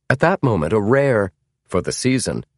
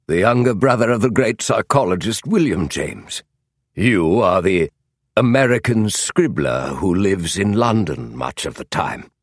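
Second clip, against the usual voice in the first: emphatic upper-class British gentleman; identify him with brusque British authority; voiced Sherlock Holmes. Holmes identifying James aloud, in a commanding voice.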